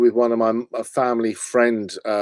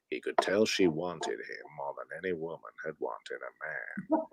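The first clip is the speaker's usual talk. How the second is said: much lower, softer documentary narrator; deep hushed film narrator; Morgan Freeman narration; 9 semitones lower, 15 dB quieter than usual. Acted. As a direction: narrate your own flirting like a wildlife film. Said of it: "So I'm narrating".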